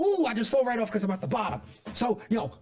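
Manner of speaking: normal talking voice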